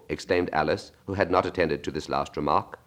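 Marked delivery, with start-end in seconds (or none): none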